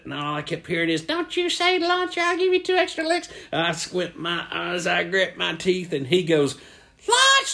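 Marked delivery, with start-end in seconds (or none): 1.0-3.3 threatening coach. Acted. Coach's warning he kept hearing, high threat. 7.1-7.5 high, loud burst; yelled out. Acted. Boy giving in and yelling lunch, shouted.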